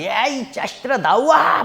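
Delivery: lightly acted high, drawn-out challenge; Goliath's taunt. Goliath challenging Israel's army, hammed-up taunt. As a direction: call them out with mock bullying swagger.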